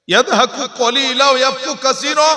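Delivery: high, loud chanted verse; fervent Arabic recitation. Real, not acted.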